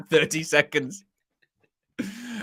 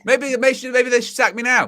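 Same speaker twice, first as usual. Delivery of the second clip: higher, louder, whiny mock lament; mock self-pitying manager; 9 semitones higher, 4 dB louder than usual. Pundit mocking a manager's meltdown, whiny and high. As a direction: ham up a manager doubting himself.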